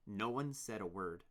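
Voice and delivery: ordinary delivery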